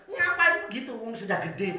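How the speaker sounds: high-pitched scolding, retold; angry outburst. Preacher recalls scolding Riel for jumping, angry outburst. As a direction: snap it as a spontaneous telling-off. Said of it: "spontan saya marah".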